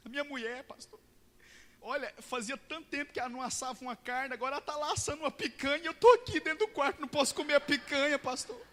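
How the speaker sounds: high, complaining mock voice; whining about the barbecue; whiny man. Dieting man complains to the pastor about his wife, high-pitched whine. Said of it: "ele começou a chorar".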